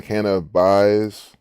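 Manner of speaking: "cannabis" slowed, last syllable like "buys"; drawn-out split pronunciation. Shown as a demonstration. Repeats split word for pun.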